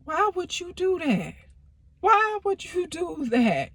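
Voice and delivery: mother hears he cheated, high and shocked; shocked mom. Retold or imitated. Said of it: "She like".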